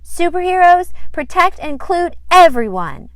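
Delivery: high, loud heroic vow; a girl proudly declaring superhero rules; proud declaration. Acted. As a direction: announce it like a brave little superhero.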